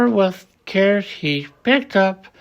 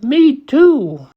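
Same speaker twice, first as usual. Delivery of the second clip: high, louder little-one chime; little kangaroo; 8 semitones higher, 4 dB louder than usual. A narrator's reading. The young kangaroo backs up her mother, high piping. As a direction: pipe up like a tiny joey. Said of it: "young kangaroo in her pouch said".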